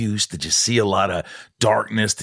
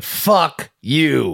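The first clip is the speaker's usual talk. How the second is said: drawn-out, higher mock insult; mock retort; 6 semitones higher than usual. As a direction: savour a pretend comeback. Imagined retort to the director.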